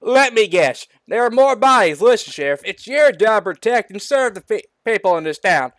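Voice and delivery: loud, high scolding mayor; disgusted. A narrator's reading. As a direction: build from exasperation to a high yell. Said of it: "looks at the sheriff with disgust".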